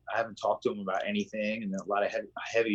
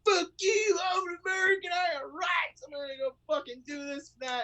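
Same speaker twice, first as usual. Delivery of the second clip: high shouted mock rant about rights; ranting angry American. Mocking a protester ranting at a friend, high shouted voice. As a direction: yell like an entitled protester. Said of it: "being like".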